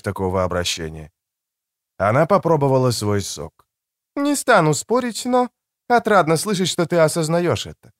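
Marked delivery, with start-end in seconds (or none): 4.2-7.9 high-pitched voice for a woman; higher female character voice; woman's voice. A narrator's reading. Narrator voices Creция's reply.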